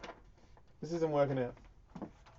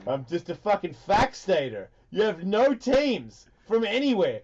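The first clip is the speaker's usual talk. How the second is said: slow, loud, higher-pitched heckling; mock sports rant; 5 semitones higher, 6 dB louder, slower than usual. Host taunts San Diego fan about teams, mock rant.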